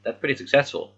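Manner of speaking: normal talking voice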